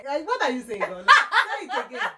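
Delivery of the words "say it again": laughing. Real, not acted. Asking to repeat, laughing.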